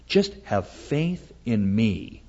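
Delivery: slow, soft divine counsel; the Lord. Acted. God speaking to the prophet, lightly voiced quiet reassurance. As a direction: gently urge trust, taking your time. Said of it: "the Lord begins to speak to Habakkuk and says".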